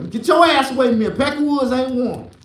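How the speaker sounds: hammed-up hostile shout; mock angry retort. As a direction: shove them off with the words. Retold hostile reply.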